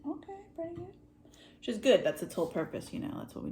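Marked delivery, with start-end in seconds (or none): 0.1-0.9 high, soft approving aside; mildly impressed, pitched up; retold approval. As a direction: quote a pleasantly surprised little reaction. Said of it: "I was like".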